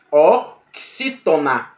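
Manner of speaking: "Oxítona" drawn out slowly, syllable by syllable, stressing XÍ. Shown as a demonstration. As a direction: sound out "Oxítona" slowly, weight on XÍ. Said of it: "a própria palavra oxítona". ("Oxítona" bem devagar, sílaba por sílaba, força no XÍ)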